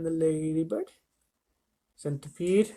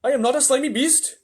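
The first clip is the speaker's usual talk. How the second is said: much higher, louder protest; indignant earthworm; 11 semitones higher, 7 dB louder than usual. Acted. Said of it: "the earthworm said".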